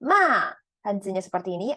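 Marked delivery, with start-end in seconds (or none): 0.1-0.5 ma falling sharply; fourth tone ma. Shown as a demonstration. Teacher showing falling ma meaning scold. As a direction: drop ma hard. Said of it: "artinya omong kotor".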